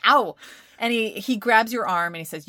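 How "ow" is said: louder hammed-up yelp of pain; mock ow. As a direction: play a sissy's exaggerated ow. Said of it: "he's like".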